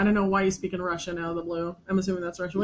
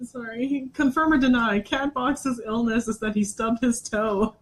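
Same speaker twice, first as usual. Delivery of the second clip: ordinary delivery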